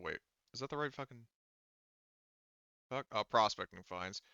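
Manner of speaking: ordinary delivery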